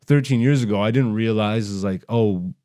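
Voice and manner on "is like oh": normal talking voice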